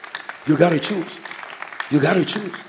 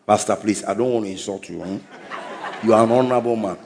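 gentle pastor; 8 semitones lower than usual. Retold or imitated. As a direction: ease it out low like a careful old pastor.

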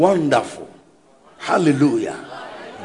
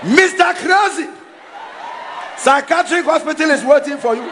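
mocking shout; 11 semitones higher than usual. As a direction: jeer at him as if he's mad. Skit: mocking a frantic caller.

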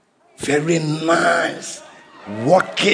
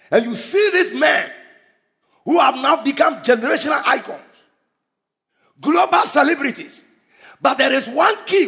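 preaching at full force; 9 semitones higher than usual. Acted. Preacher driving the point of sacrifice home to the congregation, full-force preaching. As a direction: preach it hard, driving each phrase home.

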